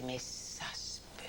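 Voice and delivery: slow, hushed awe; whispered disbelief. Retold or imitated. To herself during the national anthem, whispered disbelief. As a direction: whisper it slowly to yourself in wonder. Retelling her awed thought at the anthem.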